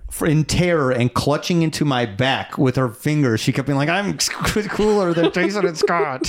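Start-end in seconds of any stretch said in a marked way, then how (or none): 3.9-6.3 high-pitched mock-scared bragging; imitating terrified bravado. Friend mimicking her boasting in the scare maze, mock-terrified. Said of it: "screaming her head off".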